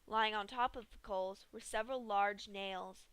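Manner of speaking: usual speaking voice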